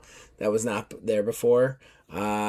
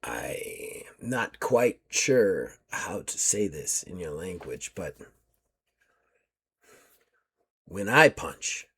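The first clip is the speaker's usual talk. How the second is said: quiet, slow threat; low menacing; 5 semitones lower, 6 dB quieter, slower than usual. Acted. Warrior woman warning the captive, quiet slow menace. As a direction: let each word land softly and slow. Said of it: "She looks at you and goes".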